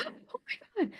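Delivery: soft, high breath of disbelief; gasped astonishment. Retold or imitated. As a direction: gasp it out, hushed, as the mom. Mom's gasp at pregnancy news.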